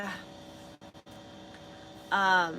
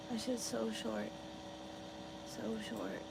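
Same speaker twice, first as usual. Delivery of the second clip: quiet; 12 dB quieter than usual. Real, not acted. Lamenting short haircut, trailing off.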